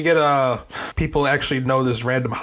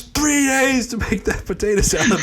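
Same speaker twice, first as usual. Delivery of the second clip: high-pitched cartoon complaint, falling away; high, put-on cartoon voice; indignant cartoon character; 10 semitones higher than usual. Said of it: "SpongeBob lands in the people's potato salad".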